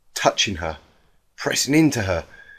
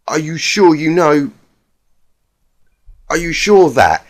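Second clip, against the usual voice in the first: higher, louder urgent questioning; Twilight, pressing; 7 semitones higher, 8 dB louder than usual. A narrator's reading. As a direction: demand reassurance.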